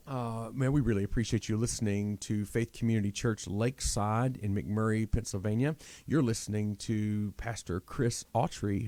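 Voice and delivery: low, even announcer read; radio host. Retold or imitated. Radio host introducing a pastor to listeners, announcer voice. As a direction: imitate a radio intermission plug. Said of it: "the guy comes on the radio".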